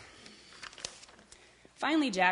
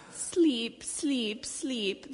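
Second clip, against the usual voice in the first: put-on higher baby voice, softening; tired babies; 5 semitones higher, much slower than usual. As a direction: plead sleepily, each word a falling sigh. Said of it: "the tired babies finally whispered".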